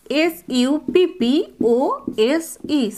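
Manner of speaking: letters spelled out one by one, slow; spelling slowly. Shown as a demonstration. Teacher spelling a word for students, slow and deliberate. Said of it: "spelling out suppose".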